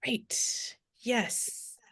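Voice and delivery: unvoiced mock whisper. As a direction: breathe it out as a mocking aside. Sarcastic whisper to son.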